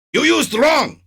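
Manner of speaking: high, loud mock Gestapo bark; a botched German accent, barked; failed German accent. Gestapo officer barking at a creator, failed German accent. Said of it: "That wasn't even a German accent".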